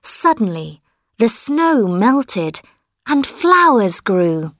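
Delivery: plain reading voice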